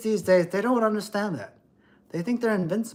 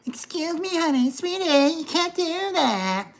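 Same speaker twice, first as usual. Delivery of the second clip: much higher, slow, sugary and condescending; 11 semitones higher than usual. Retold or imitated. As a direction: talk down sweetly like a Karen.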